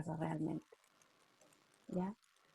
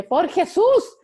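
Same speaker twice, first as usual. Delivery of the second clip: high, loud, laughing exclamation; daughter's exclamation; 11 semitones higher, 11 dB louder, slower than usual. Retold or imitated. Mother imitating her daughter's exasperated cry, high and laughing. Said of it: "mi hija tiene una exclamación que dice".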